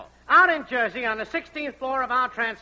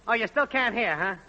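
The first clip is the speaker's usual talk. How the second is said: normal talking voice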